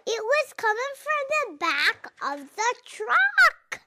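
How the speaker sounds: eager storyteller jumping ahead; excited reading. Real, not acted. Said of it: "Don't give away the story".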